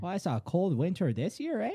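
broad mock Canadian inflection; Canadian accent. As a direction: make small talk as a put-on Canadian. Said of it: "take that, those inflections and carry it over".

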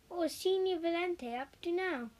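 plain reading voice

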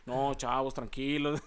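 lightly voiced soothing friend; a hint of a mellow, calming buddy; calm friend imitation. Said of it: "es de que".

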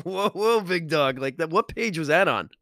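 high, laughing whoa whoa; laughing mock alarm. Laughing at the reader who quit his job, playful mock alarm. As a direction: pretend to rein him in through a laugh. Joking reply to a quitting reader.

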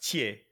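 THIERS said "tyay", silent r and s; French THIERS with a silent ending; correct pronunciation. Shown as a demonstration. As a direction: drop the ending of THIERS, finish on "ay". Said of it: "It's pronounced".

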